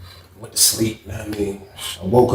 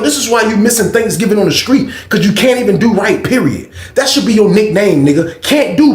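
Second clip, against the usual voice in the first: furious rant; 13 semitones higher, 6 dB louder than usual. Retold or imitated. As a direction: let the frustration explode. Raging at himself over lost tray.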